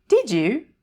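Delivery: put-on high, loud, chirpy little old woman; fairy godmother voice. A narrator's reading. Tiny woman in the biscuit tin greeting Annie, high and chirpy. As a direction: pipe up brightly from inside the tin.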